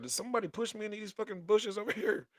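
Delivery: high-pitched mock disbelief; replayed incredulous question; retold bewilderment. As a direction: act out baffled outrage, pitch jumping up, breaking into a laugh. Retelling bewildered reaction in bushes.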